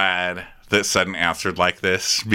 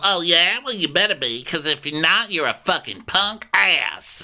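higher-pitched combative imitation; mock challenge; 8 semitones higher than usual. Erica daring Garcelle to be good, mock challenge. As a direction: taunt it, dragging out the last insult.

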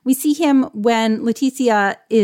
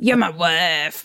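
slow, lower, hammed-up Texas accent; 4 semitones lower, slower than usual. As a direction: redo the father's line slowly in a mock Texas accent. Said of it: "That was my Texas accent".

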